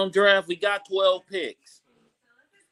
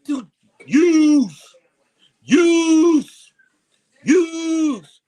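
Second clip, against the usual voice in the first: louder, higher, hammering the name; outraged repetition; 7 semitones higher, 7 dB louder, much slower than usual. Real, not acted. Listing departed players in outrage.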